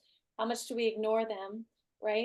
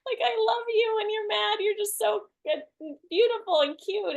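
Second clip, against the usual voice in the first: much higher, louder doting voice; gushing boyfriend; 11 semitones higher, 8 dB louder than usual. Retold or imitated. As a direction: coo at her like she's adorable. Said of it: "it was like".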